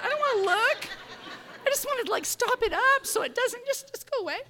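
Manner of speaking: high-pitched, squirming plea; squeamish avoidance. Retold or imitated. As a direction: push the voice way up, not wanting to look. Squeamish about dealing with shrews.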